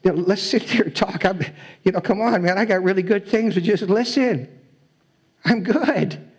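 coaxing, come-sit-with-me plea; voicing God inviting. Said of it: "He invites".

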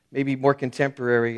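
ordinary delivery

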